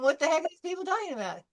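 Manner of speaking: baffled. Retold or imitated. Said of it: "And I'm going".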